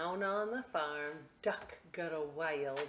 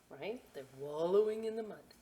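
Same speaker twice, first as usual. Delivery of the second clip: plain reading voice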